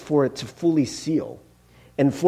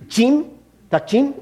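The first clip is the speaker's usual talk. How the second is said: "Jim? Dakjin?" punched higher, rising at the end; with emphasis; 6 semitones higher, slower than usual. Shown as a demonstration. Speaker showing how Korean must be said, emphatic "Jim? Dakjin?". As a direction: stress "Jim" hard, lifting it like a question. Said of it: "like, with emphasis".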